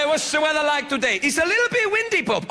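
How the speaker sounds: high, acted back-and-forth; Pope's weather chat. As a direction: play a comic exchange with rising pitch. Acting Pope and aide chatting.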